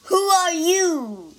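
loud, high gruff demand; demanding demon. Acted. Demon demands the monk name himself. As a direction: bark it at the stranger. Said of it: "demanded the demon".

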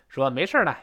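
usual speaking voice

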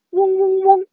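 high, sustained humming buzz; bee buzz. Shown as a demonstration. Sister showing brother throat vibration, buzzing like a bee. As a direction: hum steadily like a bee. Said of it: "像蜜蜂一样".

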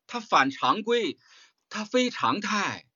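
each word laid out slowly and weightily; teacher slows right down to drive the point home; slow emphatic stress. Shown as a demonstration. Teacher to students, slow and weighty emphasis.

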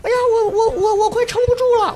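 imitating panic. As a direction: voice the girl who can't hold on. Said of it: "她说".

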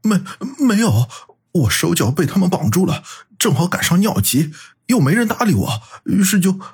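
high, flustered stammer; stammering excuse. A narrator's reading. Qi Bing denying he wet himself, stammering. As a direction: stumble through an embarrassed explanation. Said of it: "骑兵结结巴巴地解释道".

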